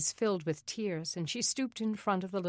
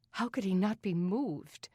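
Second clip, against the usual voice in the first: ordinary delivery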